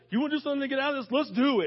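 high, loud, rapid-fire enthusiasm; eager, rallying. Acted. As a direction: jump in as an eager helper. Preacher acts out an eager Jesus.

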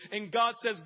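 ordinary delivery

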